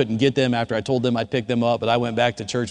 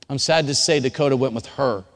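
usual speaking voice

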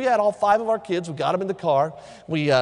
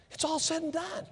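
all said and done, dropped softer like an echo; 11 semitones higher, 8 dB quieter than usual. Shown as a demonstration. Pastor repeating his point to the church, suddenly soft. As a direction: let all said and done fall quietly. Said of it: "but when it's all said".

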